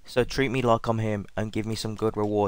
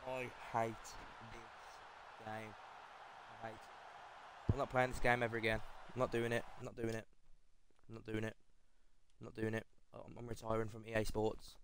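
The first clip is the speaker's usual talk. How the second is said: very quiet, drained disbelief; stunned despair; 17 dB quieter than usual. Real, not acted. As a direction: barely get it out, utterly defeated. Crushed by the pack result.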